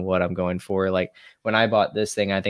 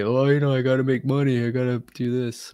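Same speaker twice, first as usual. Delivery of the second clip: mock complainer; 4 semitones higher than usual. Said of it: "A lot of people are gonna say".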